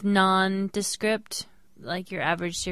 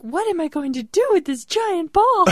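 much higher, louder mock-hopeless clerk; 10 semitones higher, 6 dB louder than usual. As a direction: wail it like a stuck clerk.

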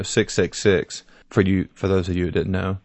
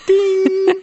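mock bell ding; 4 dB louder than usual. Playful sound effect.

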